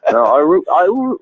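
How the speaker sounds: normal talking voice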